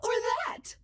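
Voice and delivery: AI shows a high voice. Shown as a demonstration. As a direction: pitch it way up. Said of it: "I can sound like anyone".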